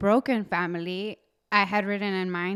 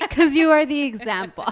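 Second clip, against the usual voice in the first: laughing, louder teasing; laughing tease; 4 semitones higher, 5 dB louder than usual. Real, not acted. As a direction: tease the co-host through laughter.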